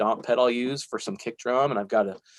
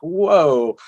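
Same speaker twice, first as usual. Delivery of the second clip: retold surprise; 4 semitones higher, 6 dB louder, slower than usual. Reacting to his old videos.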